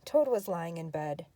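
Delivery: plain reading voice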